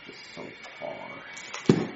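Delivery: unvoiced slow whisper to himself. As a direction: breathe it out under your breath. Muttering alone at the door.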